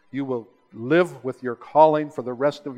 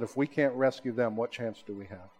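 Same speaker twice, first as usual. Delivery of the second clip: solemn retold question; 4 semitones lower, 10 dB quieter than usual. Mentor placing himself among the weak, low and sober. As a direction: drop low and soft.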